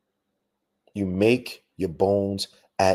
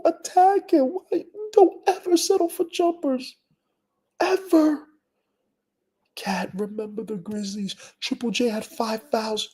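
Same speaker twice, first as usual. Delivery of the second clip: exasperated rant. Real, not acted. Fan venting at Cat for settling for jumpers, high exasperated rant. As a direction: rant it in a high cracking voice of disbelief. Ranting at Cat's shot choices.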